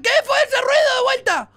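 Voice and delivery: high, loud alarmed question; startled shout. Real, not acted. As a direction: burst out, alarmed by a noise. Startled by noise again. (pregunta a gritos, sobresaltado)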